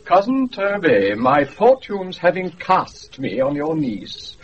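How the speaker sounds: pompous grandeur. Acted. Malvolio imagines his lordly speech.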